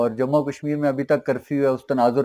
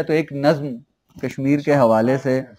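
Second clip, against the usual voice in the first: normal talking voice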